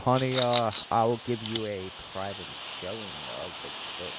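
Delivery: low, slow, soft sleazy come-on. Acted. Host offering his partner a private kilt show, sleazy. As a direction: purr it like a seedy seducer. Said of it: "he made that sound so sleazy".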